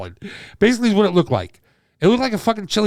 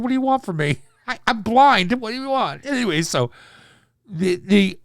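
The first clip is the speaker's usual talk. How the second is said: usual speaking voice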